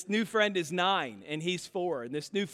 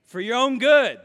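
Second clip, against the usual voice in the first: louder, higher, stern parental warning; parents' stern saying; 5 semitones higher, 9 dB louder than usual. Pretend. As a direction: boom it out like a scolding parent. Preacher quoting parents' stock phrase.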